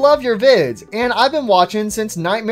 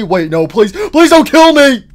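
mock terror rising to a loud cry; panicked pleading; 6 semitones higher, 7 dB louder than usual. Redstone head about to be mined, panicked pleading. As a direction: beg for your life, pitch climbing. Character begs not to be mined.

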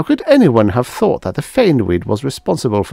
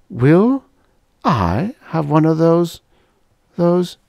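anxious question; 9 semitones higher, slower than usual. Acted.

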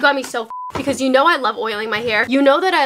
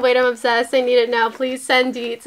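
ordinary talk

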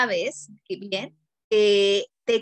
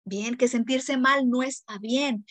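ordinary delivery

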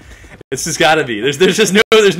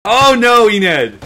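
usual speaking voice

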